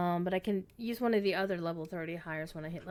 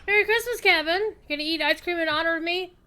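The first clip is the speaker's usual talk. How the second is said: excited; 7 semitones higher, 5 dB louder than usual. Pretend. Reading NPC line aloud playfully.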